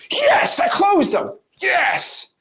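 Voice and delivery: very high, drawn-out victory cry; hammed-up celebration of a closed deal; mock triumph. Guru celebrates a fake closed deal.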